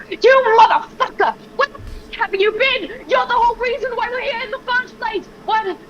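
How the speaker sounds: furious yell at him. Acted. Estrella slams the cell bars and yells at him. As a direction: scream at him for dragging everyone here. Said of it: "she slams her fucking hands... she's like".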